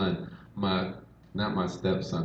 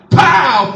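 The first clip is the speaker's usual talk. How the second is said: loud, high explosive "pow"; sudden punchy sound effect; shouted bang imitation; 9 semitones higher, 11 dB louder than usual. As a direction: burst out like a door blown open. Imitates door bursting off hinges.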